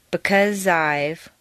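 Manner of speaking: BECAUSE I'VE slowly blended; slow, smooth join in BECAUSE I'VE; linked words. Shown as a demonstration. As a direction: carry the Z sound of BECAUSE straight into I'VE. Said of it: "blend together smoothly".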